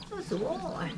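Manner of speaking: high, soft coo. Real, not acted. Keeper praising a chick, soft high coo. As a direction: praise a tiny pet softly and high. Cooing over a tiny chick.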